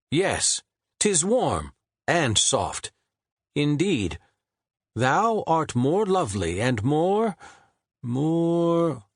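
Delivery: high, slow, lofty poet voice; Shakespeare groping for a word. A narrator's reading. Shakespeare composing aloud, searching for the word. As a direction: savor the words then falter.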